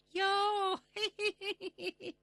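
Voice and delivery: high, playful "yo" into giggle. Acted. Rabbit's plea amuses the fairy, high giggle. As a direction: titter in a high character voice.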